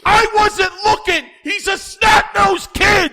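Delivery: high, loud outraged protest; indignant yell. Real, not acted. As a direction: shout your excuse. Heated defensive protest.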